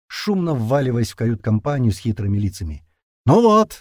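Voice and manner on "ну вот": loud, high cheerful call; cheerful shout. Acted. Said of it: "весело прокричал Леднев".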